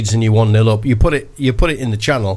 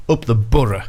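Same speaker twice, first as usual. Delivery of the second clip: BOROUGH as "Burra", in a Borough accent. Shown as a demonstration. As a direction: local Borough accent, BOROUGH ending in a short "uh". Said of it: "He's got a great Borough accent".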